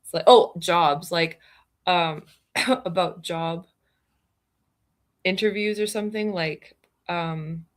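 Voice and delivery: ordinary delivery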